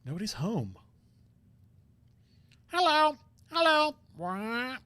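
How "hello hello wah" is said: squawky, much higher parrot imitation; badly faked parrot squawk. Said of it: "That's the worst parrot".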